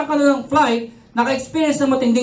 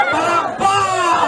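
long high shout of Palakpak; drawn-out clap cue; 7 semitones higher, much slower than usual. Real, not acted. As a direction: stretch it out slowly for the room. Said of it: "crowd clap cue".

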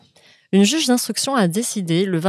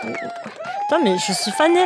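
squeaky pitched-up voice effect; sped-up chipmunk mouse filter; slower than usual. Real, not acted. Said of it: "avec les voix de souris".

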